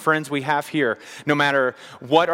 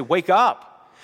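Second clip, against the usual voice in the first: Jesus pleading with religious people, urgent raised cry; 6 semitones higher than usual. Retold or imitated. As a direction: plead with them to wake up. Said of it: "he is pleading with these religious people".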